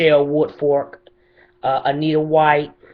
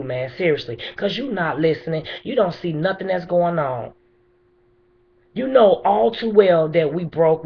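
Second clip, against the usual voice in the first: pleading furiously with the mayor; impassioned angry plea; 6 semitones higher than usual. Real, not acted. Grieving mother confronts the mayor.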